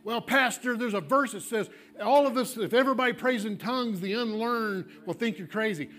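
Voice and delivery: skeptical objector. Retold or imitated. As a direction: push back on the pastor, half mocking. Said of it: "objecting congregant voiced".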